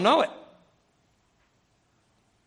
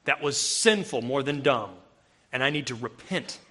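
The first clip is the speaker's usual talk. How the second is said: sober self-rebuke; 8 semitones lower, 3 dB quieter than usual. Retold or imitated. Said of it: "Act like a man and take it".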